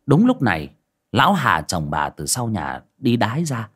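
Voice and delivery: ordinary delivery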